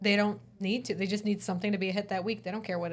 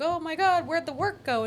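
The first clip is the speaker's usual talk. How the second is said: higher-pitched imitation of worried people; mock panic; 8 semitones higher than usual. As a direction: act out mild alarm at a sudden loss. People panicking when work dried up.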